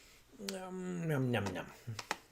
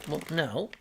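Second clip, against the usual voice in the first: normal talking voice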